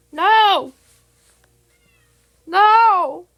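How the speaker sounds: scolding shout at a pet; shouting at the cat. Real, not acted. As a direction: stop the cat right now.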